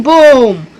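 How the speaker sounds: ordinary delivery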